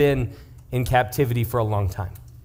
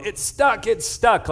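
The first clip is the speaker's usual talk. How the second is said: higher, panicked repeat of stuck; retold panic; 6 semitones higher, slower than usual. Son panicking to mom about candy stuck, high panicked cry. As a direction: mimic a scared kid with candy caught in his throat. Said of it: "Janae's calling me in a panic".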